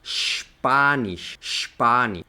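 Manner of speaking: "Spanish" begun with a long sh; shp-AH-nish, slowly, long open A; pronunciación alemana. Shown as a demonstration. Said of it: "español en alemán se lee". ("Spanish" a la alemana, empezando con sh)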